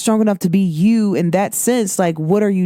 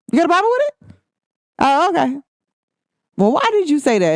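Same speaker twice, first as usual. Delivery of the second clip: mock accuser; 7 semitones higher, faster than usual. Imitating accusers.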